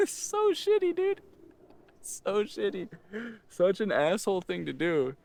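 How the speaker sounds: squeaky high laugh through the words; laughing cringe. Real, not acted. Storyteller recalling an asshole thing he did, laughing. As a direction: crack up at your own awful behaviour. Laughs at own awkward move.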